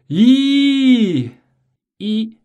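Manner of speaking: high, loud held "一"; shouted vowel. Shown as a demonstration. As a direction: call out "一" as loud as you can. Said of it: "你要喊救命".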